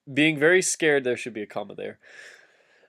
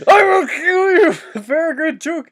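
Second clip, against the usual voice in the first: much higher and louder mock wizard threat; hammed-up Gandalf; 7 dB louder than usual. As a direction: shriek the threat as a cartoon wizard, laughing. Gandalf voiced threatening hobbit.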